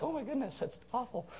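high, soft mock sympathy; mock dismay. Listener hearing about China, mock dismay. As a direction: imitate a shocked listener, high and quiet. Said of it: "you're like".

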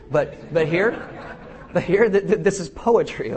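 words broken up by real laughter; laughing. Real, not acted. Preacher laughing after his Riverdance joke, trying to go on. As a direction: push through while laughing.

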